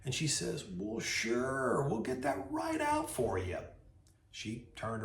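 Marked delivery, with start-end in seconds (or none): 0.8-3.6 Southern lady. Retold or imitated. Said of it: "moseys over to the window very slowly".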